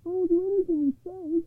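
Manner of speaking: high, anxious pleading voice; high-pitched troubled poor man. A narrator's reading. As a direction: promise eagerly in a high, worried voice. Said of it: "answered the troubled man".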